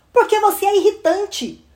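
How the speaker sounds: high, exasperated retort; annoyed big sister. Acted. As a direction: tell off the pesky little sister. Annoyed sister answers pest.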